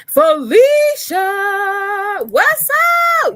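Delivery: loud, high, drawn-out sing-song call; excited shouted greeting. Real, not acted. Coach spots client joining the live, shouted sing-song greeting. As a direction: holler the name like a long-lost friend.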